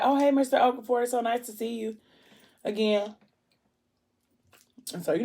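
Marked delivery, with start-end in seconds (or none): 0.0-3.1 high-pitched polite lady voice; friendly greeter. Retold or imitated. Lady greeting Mister Okafor at the hospital, friendly. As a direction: sing out a sociable welcome, pitched up.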